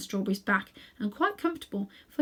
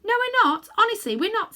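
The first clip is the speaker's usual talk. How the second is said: put-on quick, high girl's protest; hasty; 14 semitones higher, 7 dB louder than usual. A narrator's reading. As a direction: rush out the denial. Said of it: "said Polly hastily".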